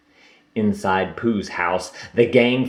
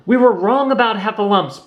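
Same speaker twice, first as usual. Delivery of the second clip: protesting; 11 semitones higher, 4 dB louder than usual. Acted. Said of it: "protested Roo".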